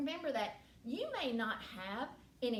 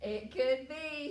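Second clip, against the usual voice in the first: usual speaking voice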